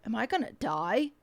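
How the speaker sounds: half-joking fear, said under the breath; scared little aside. Retold or imitated. Scared aside in hostage taxi.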